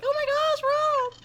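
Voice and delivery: high squealing surprise; excited squeal. Retold or imitated.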